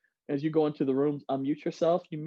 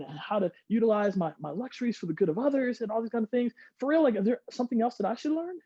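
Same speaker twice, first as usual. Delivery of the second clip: acted curious conversation; 6 semitones higher than usual. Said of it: "Power of three".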